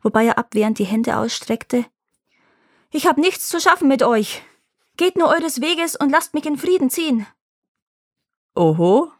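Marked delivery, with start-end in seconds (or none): 2.9-7.3 high, hurried panicked plea; hasty frightened cry. Acted. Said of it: "er rief hastig". 8.5-9.1 sly goat man voice. Acted. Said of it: "stieß ein meckerndes Lachen aus".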